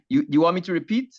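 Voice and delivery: normal talking voice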